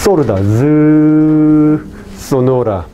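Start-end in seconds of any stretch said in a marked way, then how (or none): 0.4-1.8 long held voiced "z"; sustained "z" as a phonetics demo; voiced buzzing sound. Shown as a demonstration. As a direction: hold "z" out with the vocal cords buzzing. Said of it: "sí hay vibración".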